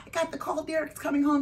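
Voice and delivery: high-pitched retold announcement; tearful joy, retold. Telling her manager the son is coming home, overjoyed. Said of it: "I got tears streaming down my face".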